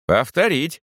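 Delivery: high-pitched order, not shouted; commanding. A narrator's reading. Chaplain orders his servant to repeat the eviction, commanding. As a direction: issue it as a brief military command. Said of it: "скомандовал фельдкурат".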